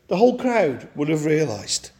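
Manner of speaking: ordinary talk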